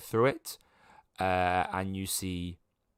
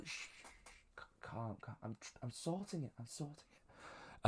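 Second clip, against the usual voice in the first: calming someone in a much softer voice, higher; hushed soothing; 3 semitones higher, 16 dB quieter than usual. Acted. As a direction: hush them down softly. NPC soothing someone off-mic.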